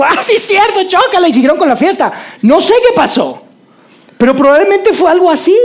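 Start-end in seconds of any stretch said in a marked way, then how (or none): 0.0-1.3 high, cheery mock agreement; Matthew agreeing. Matthew cheerfully accepting Jesus' words, high and bright. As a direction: give a breezy high-five voice, much higher. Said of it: "él dijo".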